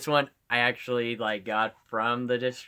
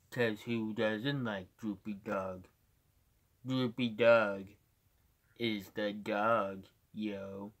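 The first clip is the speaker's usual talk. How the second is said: slow, soft, drooping cartoon dog voice; Droopy Dog; 3 semitones lower, 6 dB quieter, slower than usual. Shown as a demonstration. Streamer showing off impressions, slow Droopy Dog voice. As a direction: plod through it, sinking at the ends. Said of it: "I can do a pretty good droopy dog".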